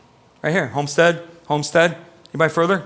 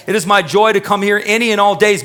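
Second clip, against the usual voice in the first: normal talking voice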